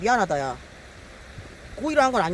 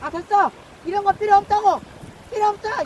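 much higher, louder shouts; frustrated yell; 12 semitones higher, 4 dB louder than usual. Real, not acted. Angler yelling at fish stealing bait, frustrated yell.

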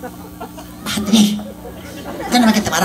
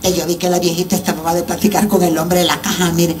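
normal talking voice

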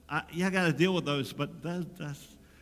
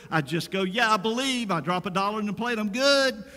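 higher and louder mockery of a careless believer, fast in places; 4 semitones higher, 6 dB louder than usual.

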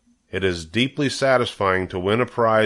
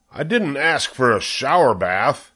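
indignant retort; 4 semitones higher than usual. A narrator's reading. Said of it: "said Mister Zuckerman".

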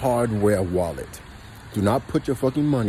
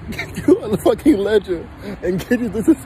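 talking through real laughter; laughing; 8 semitones higher than usual. Real, not acted. Telling viewers to get a ledger, laughing. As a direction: laugh while urging them on. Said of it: "I'm laughing because".

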